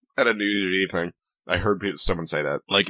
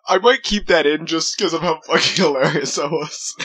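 laughing; 8 semitones higher than usual. Real, not acted. Laughing after the bit.

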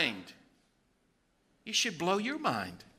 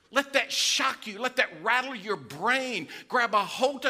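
fiery preaching; 5 semitones higher than usual. Acted. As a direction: preach it to the back row. Preaching at full force.

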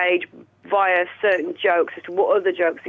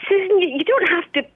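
higher sing-song Welsh accent, imitating Dad; 6 semitones higher than usual. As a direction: do her dad's Welsh accent. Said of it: "That was a very good Welsh accent".

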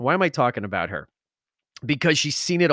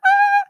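mock high note; 7 dB louder than usual. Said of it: "who does, like, that".

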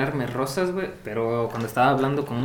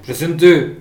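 louder put-on roll-call reply; mock sing-song roll-call answer, louder; imitating a roll-call answer; 9 dB louder than usual. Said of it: "Presente, sí, pinche Gil".

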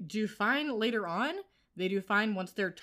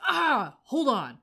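higher, louder mock outburst; retold startled cry; 4 semitones higher, 7 dB louder, much slower than usual. Retelling a character stopping mid-walk, startled loud cry. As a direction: call it out suddenly to halt someone. Acts out character's alarmed halt.

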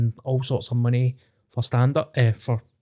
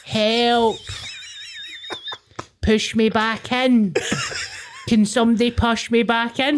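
put-on washed up baby mermaid; 10 semitones higher than usual. Shown as a demonstration. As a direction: wail like a stranded baby mermaid. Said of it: "washed up baby mermaid impression".

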